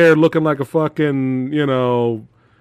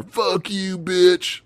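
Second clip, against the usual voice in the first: lightly voiced action-hero defiance; mock taunt; 3 semitones higher, 3 dB quieter, much slower than usual. Host imitates character crashing into the car, mock taunt. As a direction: imitate a drawn-out parting insult.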